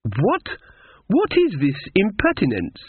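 high, swooping outrage; choleric haughty lady. A narrator's reading. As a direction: splutter indignantly in a grand lady's voice. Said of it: "The choleric woman".

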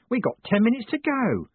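high, cheery character voice; cheerful. A narrator's reading. Mad Owl telling Moist there is time, cheerful high voice. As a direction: pipe it up brightly and eagerly. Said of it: "he said cheerfully".

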